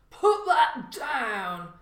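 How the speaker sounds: slow, loud growl; growled threat. A narrator's reading. Stranger catching the burglar, slow loud growl. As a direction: menace him to drop it. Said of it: "a man growled".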